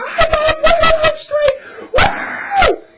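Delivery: very high, loud whooping yell; sarcastic screaming proclamation; mock street shout. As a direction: shout it running down the street, hammed up.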